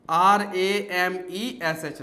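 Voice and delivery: letters spelled out one by one, very slow; spelling out slowly. Shown as a demonstration. Teacher slowly spells Ramesh letter by letter for students. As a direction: dictate each letter slowly. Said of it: "सबसे पहले लिखिए रमेश".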